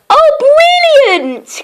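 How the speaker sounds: high, slow gushing delight; hammed-up delight, pitched well up; delighted cry. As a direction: gush over it with pretend joy. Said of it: "cried Little Miss Dull".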